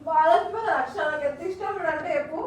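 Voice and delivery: tearful. Real, not acted. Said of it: "ఎందుకు ఏడుస్తున్నావు అక్క".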